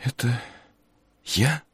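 high, soft bewildered question; wondering, barely aloud; dazed whisper. A narrator's reading. Harey voiced, dazed.

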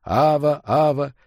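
imitated echo, fading on the repeat. A narrator's reading. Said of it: "голос разлетелся эхом". (затихающее эхо)

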